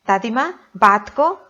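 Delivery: ordinary talk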